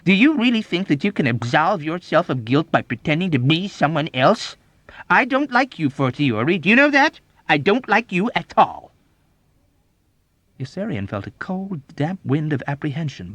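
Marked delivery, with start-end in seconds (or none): 0.0-8.9 high, loud accusing outburst; scornful tirade; vitriolic scorn. A narrator's reading. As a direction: berate with open contempt. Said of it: "exclaimed with vitriolic scorn".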